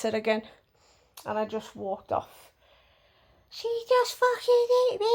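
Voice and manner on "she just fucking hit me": very high-pitched mock victim voice; mocking whiny complainer. Neighbour tattling to Peter after being hit, high mocking imitation. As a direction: mimic her complaint in a squeaky put-on voice.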